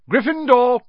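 high, loud shout; ringing high-pitched cry; shouted announcement. A narrator's reading. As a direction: shout the verdict to the whole hall. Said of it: "shouted the hat".

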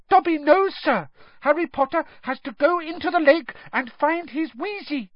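eager, very high elf voice; high-pitched earnest little elf; squeaky house elf. A narrator's reading. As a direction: squeak out urgent news.

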